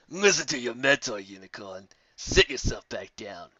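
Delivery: slow, mildly menacing bully; a hint of a smug threat; sneering. The griffin ordering Twilight to sit down.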